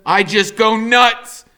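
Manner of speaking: loud, slow, rising outburst; going nuts. A narrator's reading. Max losing it in the hospital hall, a slow loud outburst. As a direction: let it burst out slowly and loud. Narrator snapping in the hallway.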